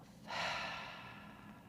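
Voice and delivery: long open-mouthed sigh out; audible releasing breath; demonstrated exhale. Shown as a demonstration. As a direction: breathe it all out through the mouth. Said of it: "let it out through the mouth".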